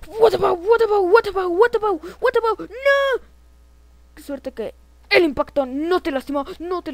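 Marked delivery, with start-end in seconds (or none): 0.1-2.6 high, loud looping alarm cry; play-acted panic chant; frantic repeated yell. Frantic outburst during alien attack. 2.7-3.2 high, loud cry of dismay; play-acted horror; shouted no. Game mishap.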